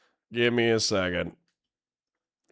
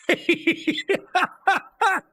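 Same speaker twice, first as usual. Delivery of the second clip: high wheezing laugh; helpless laughter. Real, not acted. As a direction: laugh high until only wheezes remain. Cracks up at the image.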